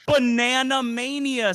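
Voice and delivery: high, drawn-out gleeful cry; excited announcement. Real, not acted. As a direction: trumpet the game title slowly and high. Breaking thrilling game news to friend.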